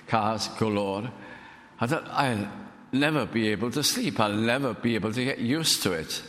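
ordinary delivery

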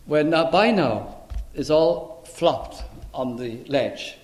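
ordinary talk, nothing marked

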